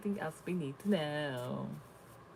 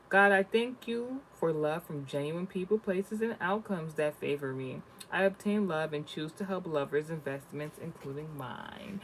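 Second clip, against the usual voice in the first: slow, louder prayerful affirmation; affirmation reading; 2 semitones higher, 4 dB louder, slower than usual. Acted. Vlogger reading affirmations to viewers, slow and prayerful. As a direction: read it like a prayer.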